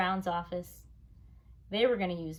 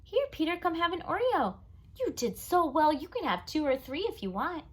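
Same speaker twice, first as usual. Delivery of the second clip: much higher, sugary praise; sweet coaxing; 9 semitones higher than usual. Acted. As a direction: coo at him to make Fudge jealous. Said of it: "Janet said".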